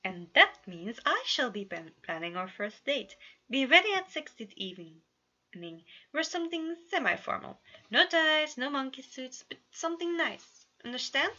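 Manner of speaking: lightly voiced dramatic prince; a hint of a regal, theatrical suitor; grand Roman. A narrator's reading. Roman sets up the date with Virgil, lightly regal.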